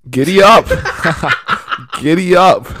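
high, loud "giddy up" with laughter; shouted catchphrase. Pretend. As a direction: holler it like a rallying catchphrase, cracking up. Shouts pun on player's name.

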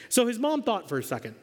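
usual speaking voice

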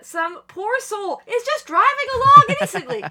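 high, loud, slowing mock sympathy; excited storyteller voice; mock pity. Real, not acted.

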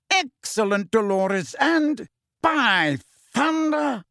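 high, gloating delight, slowing at the end. A narrator's reading. Fudge praising Dolores for the find, delighted. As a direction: savour it, drawing out the last words. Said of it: "a smile spreading across his face".

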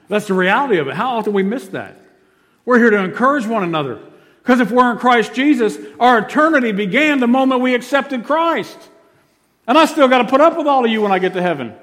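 high, loud, impassioned proclamation; full-force preaching. Real, not acted. Preacher urging the church to encourage one another, full-force preaching. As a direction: drive it home at full volume.

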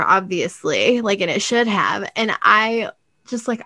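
ordinary talk, nothing marked

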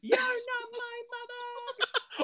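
high, slow, laughing retort; laughing sass. Pretend. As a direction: fire back through laughter.

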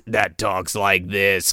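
low, slow electronic-larynx voice; slow, deep put-on voice-box drone; voice box imitation. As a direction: mimic a smoker's voice box. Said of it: "voice box".

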